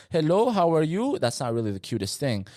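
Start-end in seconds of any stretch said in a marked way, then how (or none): 0.1-1.2 mock thick Colombian Spanish accent, higher and louder. Mocking his own teen self's Colombian accent. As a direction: lightly send up his teenage Colombian accent. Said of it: "had a thick accent".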